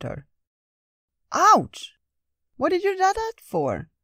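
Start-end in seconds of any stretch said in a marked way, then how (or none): 1.3-3.9 loud, much higher ouch of sudden pain; pained yelp. Acted. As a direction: yelp as if just pinched. Lynx pinches her.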